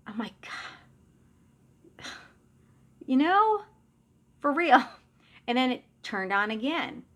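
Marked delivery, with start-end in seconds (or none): 3.1-4.8 much higher, louder, fed-up plea; exasperated. Retold or imitated. Mother pleading with her dead father, loud and exasperated. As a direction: throw up your hands and beg him to stop.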